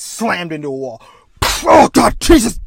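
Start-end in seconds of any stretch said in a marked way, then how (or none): 1.6-2.7 high, loud hammed-up cry of pain; mock agony; pained yelp. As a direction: yell as you hit the wall. Captain slammed into wall.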